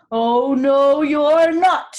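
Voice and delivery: loud troll boom. A narrator's reading. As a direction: bellow it out as the hungry troll. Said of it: "said the troll".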